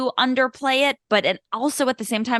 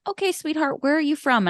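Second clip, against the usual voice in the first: high, sweetly patronising voice; casting director; 7 semitones higher than usual. Retold or imitated. Casting director asks child auditioner, high sugary voice. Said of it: "the casting director was like".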